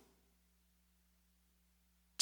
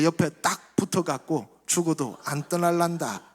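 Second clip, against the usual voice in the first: Gyeongsang dialect reading. Acted. Pastor ending with Psalm 23 in Gyeongsang dialect. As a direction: read it blunt and lively, in Gyeongsang dialect. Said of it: "마지막으로 우리 경상도 버전 한번".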